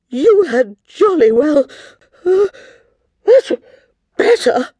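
high, loud words breaking into sneezes; sneezing queen. Acted. Queen ordering the girls to help, sneezing mid-command. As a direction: sneeze out the last word explosively. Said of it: "sneezed Queen Moo".

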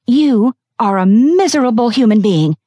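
furious fired employee; contemptuous insult. Acted. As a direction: spit the insult at him. Confronting her boss.

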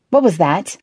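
sharp, mildly acted demand; a sudden challenge to an unknown noise; understated wary demand. A narrator's reading. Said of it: "a voice demanded".

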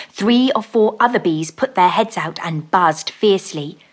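ordinary delivery

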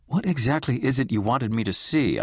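ordinary delivery

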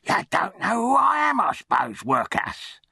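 high, taunting bully; arrogant charity boy. A narrator's reading. Charity boy lords it over the workhouse orphan, high arrogant taunt. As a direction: lord it over the newcomer, starting very high and easing down.

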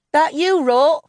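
high, loud call from another room. A narrator's reading. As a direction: shout the question through the house. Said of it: "Jill called out now".

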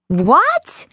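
a high, startled shriek; mild shrieked What. A narrator's reading. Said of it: "Patsy shrieked".

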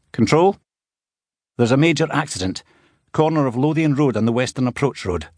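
tense police officer phoning in a major accident; McLean on the phone. A narrator's reading. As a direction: alert cop reporting a crash to control.

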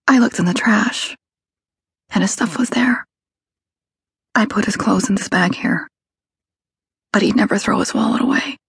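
hushed and scared; breathy, frightened voice. A narrator's reading. Kelly voiced, whispery.

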